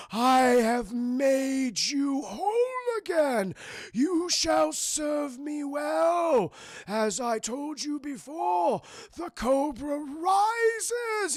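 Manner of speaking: slow, high, loud theatrical villain proclamation; drawn-out shouted villain voice; hammy villain. Retold or imitated. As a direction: proclaim it like a cartoon overlord. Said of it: "Joseph Gordon-Levitt's like".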